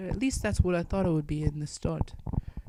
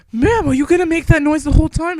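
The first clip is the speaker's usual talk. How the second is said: loud high shout; 8 semitones higher, 13 dB louder than usual. Retold or imitated. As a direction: yell over the racket. Yelling over her noise.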